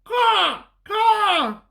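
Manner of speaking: high, loud cawing; crow caw. Acted. Storyteller voicing the crow, loud caw.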